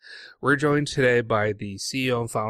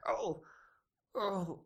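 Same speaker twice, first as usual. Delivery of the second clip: mock pain cries. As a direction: cry out while tumbling down stairs. Tumbling down stairs, hurting.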